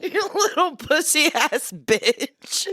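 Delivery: high laughing jab. Real, not acted. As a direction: blurt it out mid-laugh. Laughing insult at sister.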